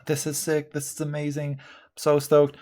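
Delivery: high, bubbly mock rave; excited client gushing. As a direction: gush like a thrilled client. Client raving about new tattoo.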